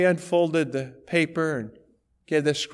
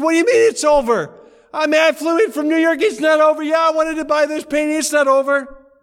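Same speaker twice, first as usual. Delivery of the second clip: loud, much higher indignant shout, fast; outraged protest; 13 semitones higher, 9 dB louder, faster than usual. Retold or imitated. Buyer who flew in from New York protesting, outraged shout.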